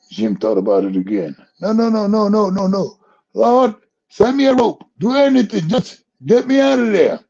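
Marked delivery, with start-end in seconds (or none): 1.6-7.2 high, loud desperate begging; frantic pleading. Retold or imitated. Jim on the cliff begging God for a rope, high loud panic. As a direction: beg in a panic.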